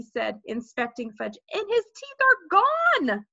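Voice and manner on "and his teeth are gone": high, slow cry of discovery; alarmed. A narrator's reading. Jimmy inspects Fudge's bloody face, alarmed. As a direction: announce it in shock, stretching the last word. Boy discovers the missing teeth.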